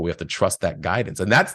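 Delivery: ordinary talk, nothing marked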